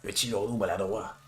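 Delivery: put-on a swaggering knight boasting in a thick Quebec accent; Zoc in Quebec accent. Streamer voicing the boastful knight to the player, Quebec accent. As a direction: puff up like a proud knight, in Québécois. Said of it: "On va lui refaire l'accent québécois".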